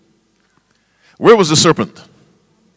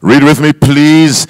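ordinary delivery

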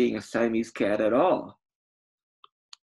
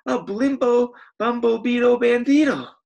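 dramatic crowd voice; 11 semitones higher, 8 dB louder than usual. Acted. Crowd names the villain.

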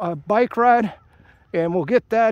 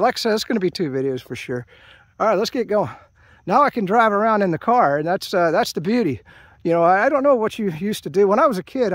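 ordinary talk

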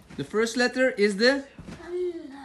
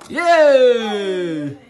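loud happy cheer; 10 dB louder than usual. Real, not acted. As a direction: celebrate the finish out loud. Cheering at the end.

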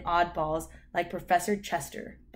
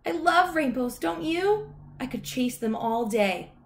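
high, cheerful dog voice; high-pitched eager talking dog; Lucky the dog; 7 semitones higher than usual. A narrator's reading. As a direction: gush like a happy puppy. Cheerful dog gushes about rainbows.

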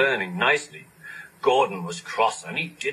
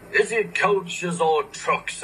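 sleepy; 7 semitones higher, slower than usual. A narrator's reading. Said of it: "asked Gordon sleepily".

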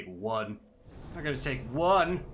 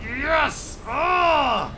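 high, loud exclamations; cheering the win; triumphant shout; 14 semitones higher, 10 dB louder than usual. Real, not acted. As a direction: celebrate out loud.

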